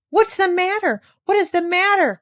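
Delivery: startled grandmother calls out in alarm; frightened cry. A narrator's reading. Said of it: "cried the frightened old woman".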